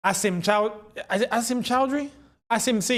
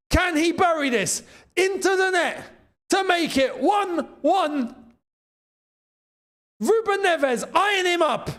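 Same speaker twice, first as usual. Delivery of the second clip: excited commentary; 9 semitones higher than usual. Real, not acted. Tense penalty build-up commentary.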